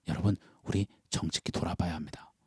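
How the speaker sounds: 속삭이듯 조용히; 숨죽여 진지하게 당부하듯; hushed appeal. Real, not acted. Solemn appeal to congregation. (속삭이며 정직한 성찰을 권하는 설교자)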